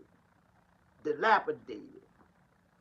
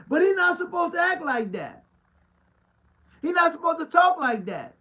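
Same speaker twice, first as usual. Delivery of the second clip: higher and louder, scandalized church folk; mocking the critics; 10 semitones higher, 9 dB louder than usual. Pastor mimics people judging him, higher and louder. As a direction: play the outraged critic.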